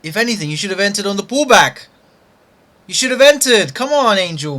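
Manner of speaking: loud, high, fast exasperated rant; frustrated scolding. Real, not acted. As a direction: berate yourself for the missed entry. Trader scolding himself over missed trade.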